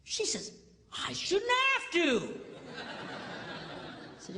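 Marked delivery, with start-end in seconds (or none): 1.1-2.4 high, slow, indignant; as if it's obvious. Retold or imitated. Woman tells pastor indignantly she shouldn't have to.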